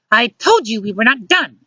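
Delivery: high-pitched insistent woman; barging in angry. A narrator's reading. Luciana barges into the bedroom, angry. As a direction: confront him in a high voice. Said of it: "Luciana barges in".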